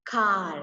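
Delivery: "Car" ending on the open vowel, R unspoken; silent R. Shown as a demonstration. Teacher explaining pronunciation to pupils, "Car" with silent R. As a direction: drop the R at the end of "Car". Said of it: "R is always silent".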